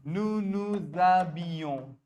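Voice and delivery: Nous nous habillons said very slowly, the z linking nous to habillons; slow model pronunciation. Shown as a demonstration. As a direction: drag out each syllable of Nous nous habillons. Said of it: "how do you say that?".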